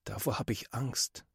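very soft, timid admission; said quietly. A narrator's reading. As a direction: barely voice the fear. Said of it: "sagt er leise". (leise und ängstlich gesagt)

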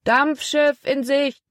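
high, long-drawn lookout call; man calling out. A narrator's reading. Man on the shore announcing the steamboat, calling out. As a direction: call it out slowly, pitched high. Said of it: "Vom Ufer erklang die Stimme eines Mannes".

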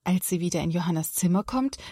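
ordinary delivery

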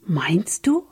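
hopeful. A narrator's reading. Sam asks his brother whether Jesus loves them, mildly hopeful. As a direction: ask with a touch of hope. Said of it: "fragte Sam hoffnungsvoll".

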